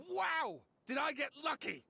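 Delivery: high-pitched exclamation of triumph; excited shout. Acted. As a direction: burst out excitedly, pitch leaping up. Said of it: "Stop shouting!".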